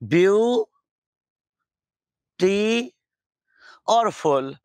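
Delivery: ब्यू, टी, फुल said as separate syllables with pauses; split into syllables. Shown as a demonstration. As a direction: break ब्यू टी फुल apart beat by beat. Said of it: "ये कुछ यूं है".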